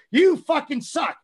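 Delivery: mock-furious yell at a lazy rookie; retold angry yell. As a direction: bark it at him like you mean it. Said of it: "I'm like".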